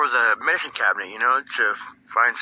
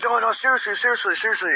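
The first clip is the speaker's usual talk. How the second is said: higher, insistent pleading repeats; 8 semitones higher, faster than usual. Retold or imitated. Pleading with laughing friends to believe him, voice raised high. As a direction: beg them to take it seriously.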